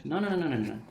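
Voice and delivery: usual speaking voice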